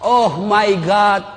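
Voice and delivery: slow, drawn-out mock plea in English; mock English prayer. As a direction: stretch it out like a hammed-up prayer. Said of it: "anak berdoa".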